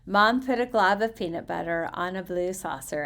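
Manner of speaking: usual speaking voice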